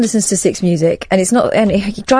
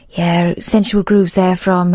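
slow, drawn-out radio presenter impression; Kerris Matthews; 3 semitones lower, much slower than usual. Imitating a radio presenter introducing a track, slow presenter voice. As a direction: draw out each word like a music-show host. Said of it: "That's a good voice you'd there".

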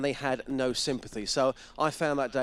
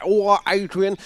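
louder, higher lopsided-mouth greeting; street cleaner impression; 4 semitones higher, 7 dB louder than usual. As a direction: imitate him calling out from a crooked mouth. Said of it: "He sounds a bit like that".

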